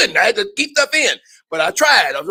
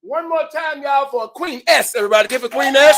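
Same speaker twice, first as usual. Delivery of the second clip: loud, raised call for applause; emcee's building shout-out; hype host; 10 semitones higher, 9 dB louder than usual. Real, not acted. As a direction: rouse the crowd to cheer the performer. Host rousing applause for performer.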